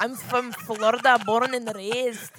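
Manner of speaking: loud fake Florida accent that sounds Scottish; bad Florida accent. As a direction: belt out a botched Florida drawl, dragging the end. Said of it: "you would sound like that if you were from Scotland".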